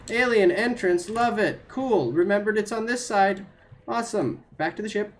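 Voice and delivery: high, loud giddy delight; bubbling over with relief; thrilled after the scare; excited relief. Real, not acted. Relief finding the alien entrance.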